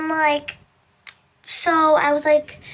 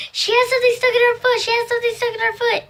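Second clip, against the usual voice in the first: fast, higher, breathless report; excited kids; 8 semitones higher, much faster than usual. Retold or imitated. Cousins rush to tell the aunt, fast and high. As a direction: blurt it out in a rush.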